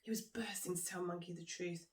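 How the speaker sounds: ordinary delivery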